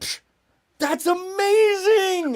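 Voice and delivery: high, slow, loud whoop of joy; overjoyed cry. Retold or imitated. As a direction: cheer it out like a delighted drunk. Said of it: "begins to party even harder".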